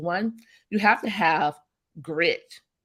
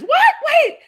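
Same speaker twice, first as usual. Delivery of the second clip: high, loud laughing shock; shocked disbelief; 8 semitones higher, 5 dB louder than usual. Retold or imitated. Guest retelling mentor hanging up, shocked squeal. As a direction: squeal in disbelief at being hung up on. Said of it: "I was looking at the phone like this".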